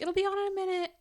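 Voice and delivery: put-on high, singsong reply to a toddler; placating mother. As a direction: reassure a little kid in a high voice. Mother answering child, high pitch.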